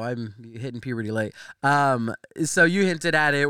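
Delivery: ordinary talk, nothing marked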